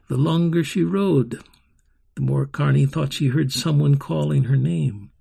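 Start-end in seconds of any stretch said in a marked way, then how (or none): none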